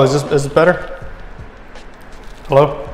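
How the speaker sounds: usual speaking voice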